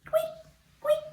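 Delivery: very high faint tweets; tiny squeak. Acted. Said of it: "Little Mouse said".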